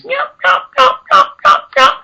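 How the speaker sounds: steady, evenly spaced yelps in a very high voice; even hen yelps. Shown as a demonstration. Hunter showing the subtler yelp cadence, very high even yelps. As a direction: keep an even cadence, hit the high note. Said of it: "Or they can be subtle like this".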